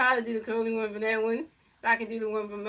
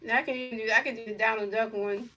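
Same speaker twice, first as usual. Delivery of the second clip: usual speaking voice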